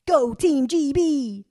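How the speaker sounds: loud, slow, hammed-up cheer with an Egyptian accent; mock cheering for Team GB in an Egyptian accent. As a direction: cheer it out big to please the driver. Cheering to the taxi driver.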